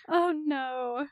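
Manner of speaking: ordinary delivery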